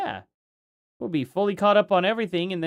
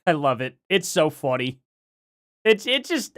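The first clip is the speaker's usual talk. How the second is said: usual speaking voice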